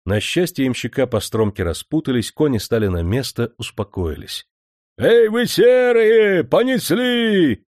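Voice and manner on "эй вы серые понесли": coachman bellowing, much higher and louder, slow; booming shout. Acted. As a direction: drive the greys off with a long call. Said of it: "зычно прокричал ямщик". (ямщик зычно кричит на коней)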